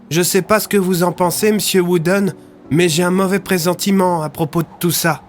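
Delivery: put-on young Rémy voice. A narrator's reading. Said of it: "déclara Rémy".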